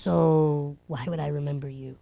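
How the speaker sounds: quiet deadpan line through a laugh; hushed, smug put-down; mock serious. Finishing the put-down to the girl, softly laughing. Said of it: "play really serious".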